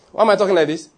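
ordinary delivery

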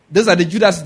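ordinary talk

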